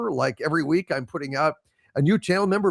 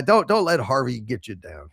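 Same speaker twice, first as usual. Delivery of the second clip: ordinary delivery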